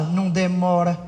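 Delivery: low, monotone mocking echo; belittling agreement. Husband echoes his wife's plea as he goes out, belittling. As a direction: agree while brushing the request off. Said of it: "ao mesmo tempo desmereceu".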